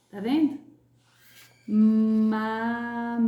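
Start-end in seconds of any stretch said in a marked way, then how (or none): 1.7-3.3 Mamão stretched very slowly, ão through the nose; very slow teacher demo of Mamão; nasal ão demo. Shown as a demonstration. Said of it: "Com som ã e ão".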